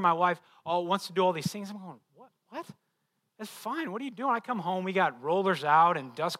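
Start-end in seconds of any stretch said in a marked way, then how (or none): 2.1-4.3 high-pitched baffled husband's protest; mock bewilderment. Husband faced with his wife's projects, mock bewildered. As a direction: act out a put-upon husband, pitched up high.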